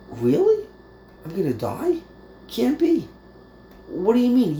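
incredulous, rising high on Can't be; mock disbelief. Teacher voicing people shocked by death, incredulous. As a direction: act shocked at hearing you will die.

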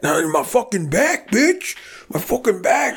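high-pitched mock complaint; Art. Storyteller imitates hurt coworker next day, high pained griping. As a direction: mimic an injured man griping.

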